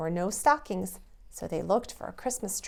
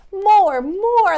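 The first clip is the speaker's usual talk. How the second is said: much higher and louder; excited cheer; 11 semitones higher, 13 dB louder than usual. Acted. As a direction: cheer for more. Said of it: "laughed Jack".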